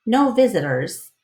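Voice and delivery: usual speaking voice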